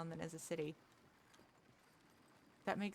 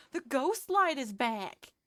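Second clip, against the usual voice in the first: much higher, louder and slow, ghost light is back; excited announcement; 8 semitones higher, 10 dB louder, slower than usual. Real, not acted. Gamer telling chat the scary light returned, excited.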